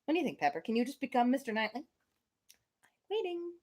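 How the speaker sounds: ordinary delivery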